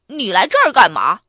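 loud startled question. A narrator's reading. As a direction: fire the question back in surprise. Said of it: "巴斯特也很吃惊". (吃惊地大声反问)

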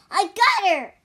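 high, loud shout of victory; excited yell; triumphant cry. A narrator's reading. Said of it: "cried Wukong".

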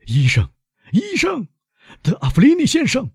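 high-pitched frantic call for the doctor; desperate shout, far above normal pitch; urgent calling out. A narrator's reading. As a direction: cry out for help, pitch soaring. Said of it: "威勒夫喊道".

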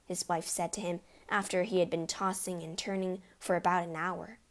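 usual speaking voice

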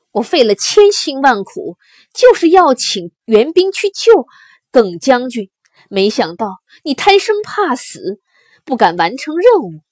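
loud, emotional reproach through narrated tears; worked-up, loud accusation; agitated reproach. A narrator's reading. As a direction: reproach the general with raw agitation. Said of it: "他激动地流下了眼泪".

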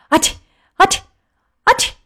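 three separate sharp sneezes; acted sneezes. A narrator's reading. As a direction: sneeze three times in a row. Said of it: "他又打了三个喷嚏". (连打三个喷嚏)

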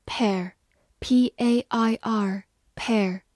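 usual speaking voice

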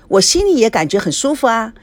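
ordinary delivery, nothing marked

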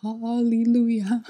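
relieved joy. Retold or imitated. Hearing her phone was found, slow joyful hallelujah. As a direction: sing out your relief slowly.

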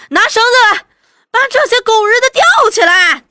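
very high, loud angry yell; clamouring shout. A narrator's reading. Villagers demanding the intruders be hanged, shouting. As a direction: yell for blood like a mob. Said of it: "又齐声喧嚷".

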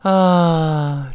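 long sigh-like open ah. Shown as a demonstration. Teacher likens the vowel to sighing, long sighed ah. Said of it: "就有点像叹气".

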